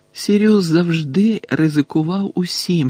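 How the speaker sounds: plain reading voice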